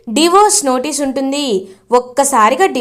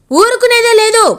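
higher and louder, final vow; firm loud warning; 8 semitones higher, 5 dB louder than usual. Acted. Father warning Roopa he won't tolerate it, loud. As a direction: slam the threat down hard. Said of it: "అని గట్టిగా చెప్తారు".

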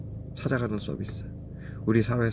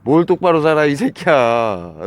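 furious yelling; 5 semitones higher, 7 dB louder than usual. Real, not acted. Yelling at the man filming him.

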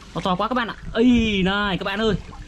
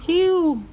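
high squeaky whoosh for the cast; mouth sound effect; 11 semitones higher, 4 dB louder than usual. Acted. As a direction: zip the line out with a high "chiu". Toy fishing, casting sound. (tiếng vút cao)

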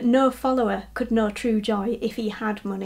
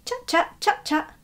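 bird chatter; 7 semitones higher than usual. A narrator's reading. Bird call imitated in narration.

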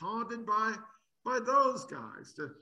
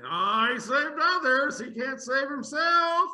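loud, slow, higher sneering jeer; mocking taunt; 7 semitones higher, 10 dB louder than usual. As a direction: jeer it at him as a scoffing priest. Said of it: "mocked him to one another".

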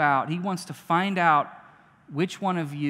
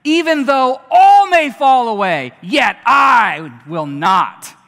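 loud vow; 6 semitones higher, 13 dB louder, slower than usual. A narrator's reading. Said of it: "But Peter said to him".

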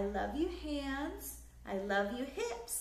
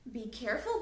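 usual speaking voice

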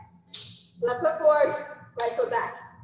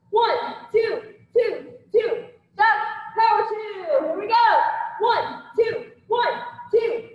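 loud, punchy combo calls; boxing coach calling combos; 7 semitones higher, 4 dB louder, slower than usual. Acted. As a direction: bark the count with energy. Instructor calling combo reps.

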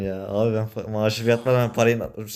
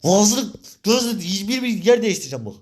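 higher, raised threatening rant; angry threat; 7 semitones higher than usual. Acted. Threatening the friend who got him in debt, raised and angry.

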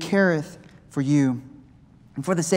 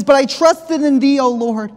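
preached out; 8 semitones higher, 7 dB louder, slower than usual. Shown as a demonstration. Verse repeated with full force.

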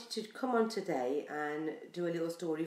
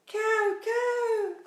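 high cawing bird call; crow caw; 13 semitones higher, 3 dB louder than usual. Acted. Reader imitates the crow for the children, high caw.

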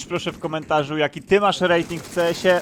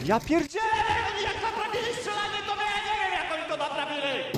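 outraged whine; 4 dB quieter than usual. Real, not acted. Raging at game shooting.

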